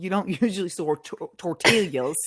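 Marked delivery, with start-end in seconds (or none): 1.4-2.2 "tortillas" with the L's sounded, laughing; mock the word "tortillas" with a hard L; exaggerated pronunciation. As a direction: mimic a friend's drawled "tortillas", L's pronounced. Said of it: "that is not how you sound".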